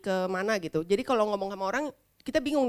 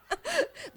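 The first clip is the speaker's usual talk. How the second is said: high laugh; 6 dB quieter than usual. Real, not acted. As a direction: burst into laughter. Laugh after joke. (tawa tinggi)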